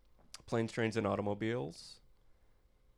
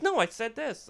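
much higher mock woman's voice; imitating his date; 12 semitones higher than usual. Comic imitates his date correcting him in a high voice. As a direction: squeak it like the offended date. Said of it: "And she goes".

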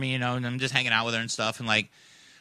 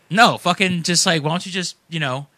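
defensive; 7 dB louder than usual. Retold or imitated. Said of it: "getting all defensive".

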